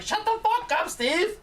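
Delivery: high-pitched cartoon scolding; catchphrase impression. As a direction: squawk it as a playful running joke.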